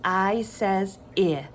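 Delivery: "eye says ih" for I says I; name I, then give short I as "ih"; letter then sound. Shown as a demonstration. Teacher leading children to repeat, I says I as "eye says ih". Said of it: "Say it with me".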